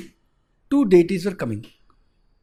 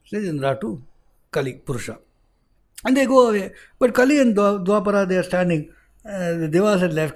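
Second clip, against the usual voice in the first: ordinary delivery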